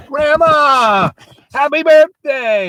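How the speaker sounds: high, loud, drawn-out shout; crowd's birthday cheer. Acted. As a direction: hail her like a whole party shouting at once. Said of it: "and everyone's".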